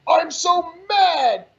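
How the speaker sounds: mock anger. Mocking liberals faking their anger, slow sarcastic whine. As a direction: drag it out, sneering at fake outrage. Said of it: "This isn't".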